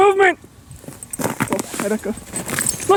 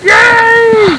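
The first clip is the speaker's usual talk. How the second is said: high, loud drawn-out cheer; 6 semitones higher, 6 dB louder than usual. Real, not acted. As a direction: cheer out loud in real triumph. Cheering the catch.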